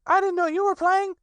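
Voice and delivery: put-on very high, eager child voice; little Roo chattering. A narrator's reading. Little Roo pesters his friends about their sticks, high and excited. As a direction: tumble the questions out like an impatient little one. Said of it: "said Roo".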